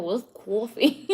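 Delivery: slow, exaggerated New York "coffee"; mock New York accent. Streamer repeating a viewer's tease about her accent, mock New York. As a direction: stretch coffee in thick New York style, amused. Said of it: "straight got a New York accent".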